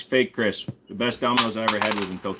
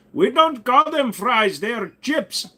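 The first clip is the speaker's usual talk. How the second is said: higher, slow put-on Indian accent; mock Indian accent; 12 semitones higher, 4 dB louder, slower than usual. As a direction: correct them in a hammed-up Indian voice. Indian persona correcting.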